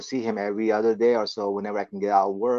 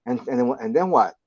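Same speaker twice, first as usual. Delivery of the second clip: ordinary talk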